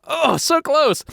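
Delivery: very high, squealing glee; high, drawn-out delighted lament; gleeful near-miss. Real, not acted. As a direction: crow in a high voice at almost winning. Gleeful at nearly tricking friend.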